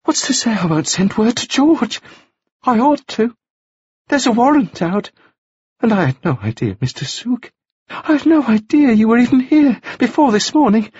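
a hint of a woman cornered and scared; woman torn between duty and a fugitive; Margaret anxious. A narrator's reading. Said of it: "she whispered".

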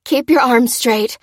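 slow, loud, effortful order; puffing instruction. A narrator's reading. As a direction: puff out the instruction. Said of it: "puffed Lucy".

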